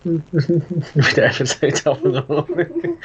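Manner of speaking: laughing through the words, amused protest. Real, not acted. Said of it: "It's the most stereotyped thing ever".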